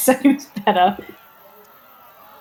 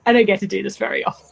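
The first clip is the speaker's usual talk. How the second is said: fast, thrilled aside; much faster than usual. Acted. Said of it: "But she's also like".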